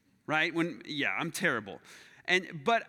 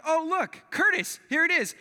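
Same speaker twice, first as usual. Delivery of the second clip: high, loud call to a buddy; excited calling out; 11 semitones higher, 6 dB louder than usual. Retold or imitated. Golfer spotting the ball calls his buddy, excited. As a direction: call out your find, pitched way up.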